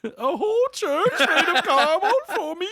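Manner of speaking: squeaky delighted gasp; high, excited voice; giddy high voice. Pretend. Repeats giddy voice.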